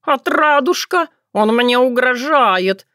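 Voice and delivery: high, slow, tattling wail; whining cat. A narrator's reading. Cat complaining of the lad's threat, whining. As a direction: draw it out as the wronged victim.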